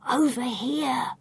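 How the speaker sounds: slow, hushed call of delight; soft cheer. A narrator's reading. Gaston beckoning his partner to a window, softly cheering. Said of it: "I hear Gaston cheering softly".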